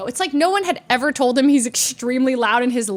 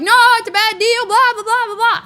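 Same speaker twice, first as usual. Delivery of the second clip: high, loud, rapid blustering; mock protest; 9 semitones higher, 5 dB louder, faster than usual. Men insisting on their bad deal, mock protest. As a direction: imitate pushy men protesting. Mimicking men pushing her into car.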